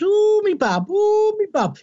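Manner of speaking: put-on high, drawn-out pantomime Brummie accent; Jess Phillips mock Brummie. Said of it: "mock pantomime Brummie character of".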